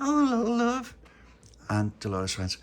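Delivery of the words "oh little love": high, affectionate greeting; fond mum. Acted. Mum opening the door to the child, fond.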